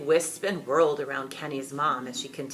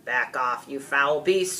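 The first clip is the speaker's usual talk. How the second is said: feisty yell; 5 dB louder than usual. A narrator's reading. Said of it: "Charlotte yelled".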